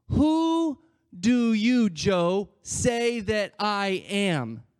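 loud, stretched WHO DO YOU; pointed question. Retold or imitated. Jesus putting the question to Joe directly, pointed question. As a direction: ask slowly and high, leaning hard on each word.

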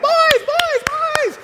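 put-on very high, sharp calling out to the kids; urgent mom call. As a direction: sound the alarm to the boys. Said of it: "She's like".

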